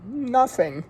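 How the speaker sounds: high, loud sheepish "Nothing"; reluctant admission. A narrator's reading. Jones admitting he has nothing to do, high and sheepish. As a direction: own up, caught out. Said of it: "he admitted".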